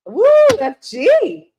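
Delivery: loud high whoop, drawn out slowly; excited whoop. Real, not acted. Reader greets viewer F G with a slow, loud whoop.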